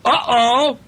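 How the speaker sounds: high, loud, drawn-out uh-oh; alarmed uh-oh. A narrator's reading. Parent telling a child the clown's in trouble, high alarmed uh-oh. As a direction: warn of trouble coming, high and slow.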